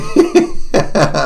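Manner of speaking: short high laugh; laughing at your own joke; amused laugh. Real, not acted. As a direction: burst into a quick high chuckle.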